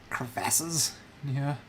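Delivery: ordinary delivery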